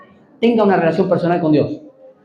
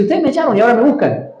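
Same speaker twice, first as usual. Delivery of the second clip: higher, accusing tone to former enemies; mild mock indignation, pointed; indignant reproach; 6 semitones higher than usual. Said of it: "Él dice". (reproche indignado, más agudo)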